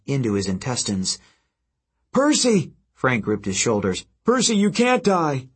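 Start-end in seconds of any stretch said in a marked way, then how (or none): 2.1-2.7 much higher, loud cry; desperate shout. Acted. Frank grips dying Percy, desperate shout. 4.2-5.5 desperate shout. Acted. Frank grips dying Percy, desperate shout.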